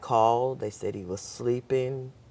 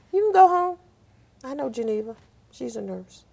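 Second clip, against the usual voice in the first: much higher and louder mock doctor; commanding doctor; 14 semitones higher, 10 dB louder than usual. Doctor sending his nurses home, much higher and louder. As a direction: play the boss dismissing his staff.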